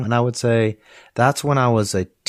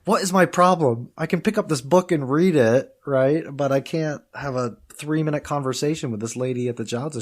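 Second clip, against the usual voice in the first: retold frustration; 6 semitones higher than usual. Student venting to himself about the jiaozi shop, frustrated. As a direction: voice your own bafflement, raised and emphatic. Retelling own frustration at speaking.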